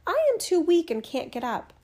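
high, weak granny voice; a feeble old woman calling out; old grandmother voice. A narrator's reading. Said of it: "said the grandmother".

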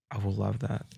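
ordinary talk

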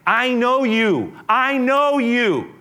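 preacher's rising cry; insistent Jesus. Acted. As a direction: press each word home, slowly. Jesus voiced, slow and insistent.

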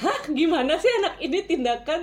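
high, loud, laughing disbelief; mock bewildered "huh?"; surprised. Kaget rekan pamit tindakan. (kaget sambil tertawa)

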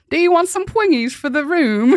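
high, singsong teasing imitation; mock punchline delivery. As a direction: deliver it high, as a tormenting catchphrase. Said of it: "torment me by starting a sentence".

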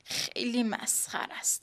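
a soft annoyed whisper; whispered aside. Acted. Neda mutters about the narrator in a whisper. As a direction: whisper it under your breath.

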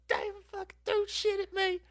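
squealing, very high tattletale voice; mock a cousin shrieking to tell on someone; imitated screaming cousin. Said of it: "she went inside screaming".

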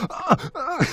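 high, laughing fake moans; hammed-up dubbing moans through laughter; mock porn moans. Actor dubbing love scene in booth, laughing mock moans. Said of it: "like a porn star getting in the booth going".

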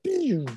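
very high-pitched "pew" noise; quick squeal of a sci-fi zap; imitated zap. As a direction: mimic a laser flash with the voice. Imitates the helmet's flash effect.

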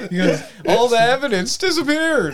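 high voice through laughter; laughing. Real, not acted. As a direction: giggle through the punchline.

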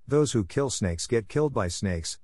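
ordinary delivery, nothing marked

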